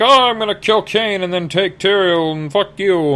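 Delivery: high, cackling villain threat; a hammed-up high witchy villain; villainous Magda. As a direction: gloat over the plan. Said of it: "Magda's all like".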